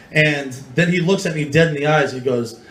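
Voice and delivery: normal talking voice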